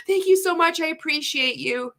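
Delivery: a mock cheerful brush-off; fake-sweet reply. Customer service answering a member, in a mock chirpy voice. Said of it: "And they'd be like".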